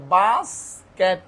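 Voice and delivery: "বাস্কেট" very slowly as baas... ket; two separated beats on "বাস্কেট"; split into syllables. Shown as a demonstration. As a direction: draw out each half of "বাস্কেট". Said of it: "দুইটা সিলাবল".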